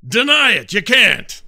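high, loud accusing shout; triumphant challenge. Acted. Adventurer shouting at the leprechaun to admit it. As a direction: dare him to deny it.

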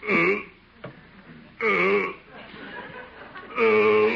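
straining grunts. Acted. Straining to chin himself.

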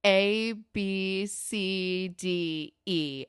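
slow, deliberate reciting of letters; spelled-out retort. Retold or imitated. Ashley answering Jimmy in code, spelled out slowly. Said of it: "But she goes".

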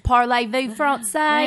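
slow, mock French accent on Parlez-vous français. Shown as a demonstration. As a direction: playfully put on a French accent. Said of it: "that sounds a lot better than".

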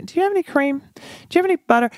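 pitched high, asking a favor; polite neighborly ask. Retold or imitated. New neighbor asking upstairs neighbors for cream, pitched high. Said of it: "and say".

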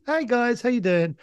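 upbeat friend. Retold or imitated. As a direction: imitate an oblivious cheery pal popping in. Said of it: "bobs in like this".

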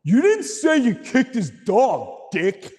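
high, slow mock scolding; high-pitched brotherly telling-off; scolding older brothers. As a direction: voice the big brothers chewing out their little brother. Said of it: "they're like".

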